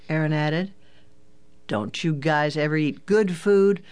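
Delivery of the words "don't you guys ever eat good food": ordinary delivery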